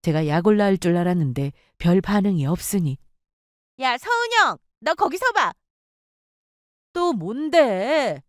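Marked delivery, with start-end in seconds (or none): 3.8-5.5 high sharp call after her. Acted. Friend calling after her. 6.9-8.2 very slow, fed-up drawl; tired narrator humoring the friend; weary. Acted.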